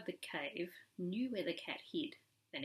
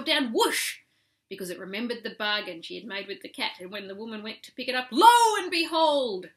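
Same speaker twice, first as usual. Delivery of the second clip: dramatic build; 5 semitones higher, 11 dB louder than usual. A narrator's reading. Storyteller's dramatic climax.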